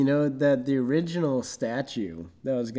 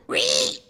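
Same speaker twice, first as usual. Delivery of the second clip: high squeal. Pretend. Sliding effect noise.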